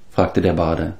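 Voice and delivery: usual speaking voice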